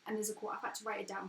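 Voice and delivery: usual speaking voice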